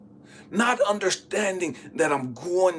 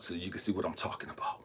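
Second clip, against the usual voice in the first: ordinary delivery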